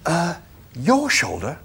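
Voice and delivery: hushed horror, barely a whisper; horrified whisper. A narrator's reading. Arthur questioning the animal's offer, horrified whisper. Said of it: "said Arthur in a horrified whisper".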